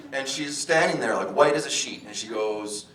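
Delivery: normal talking voice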